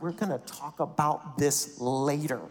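slow, low, hushed warning; mock stern warning. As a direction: measure out each word under your breath. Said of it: "I keep that look going at her".

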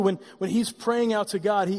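ordinary talk

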